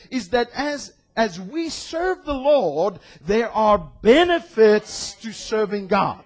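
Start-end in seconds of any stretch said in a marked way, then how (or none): none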